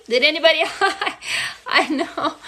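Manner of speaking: usual speaking voice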